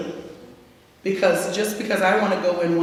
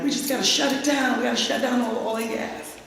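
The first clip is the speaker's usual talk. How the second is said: higher, breezy mock of an idealistic friend; imitating California friends; 5 semitones higher than usual. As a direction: parody the friends' easy certainty. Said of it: "my California friends were like".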